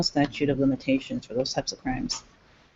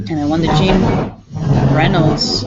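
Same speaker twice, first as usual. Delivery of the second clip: ordinary delivery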